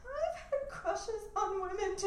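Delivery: high, slow tearful wail; hammed-up blubbering confession; mock weeping. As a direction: imitate a sobbing confession, drawn out. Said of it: "she started to weep".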